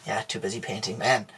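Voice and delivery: soft sighing repeat; sighing quiet echo. Acted. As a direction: breathe the words out tiredly. Host echoes quietly, sighing.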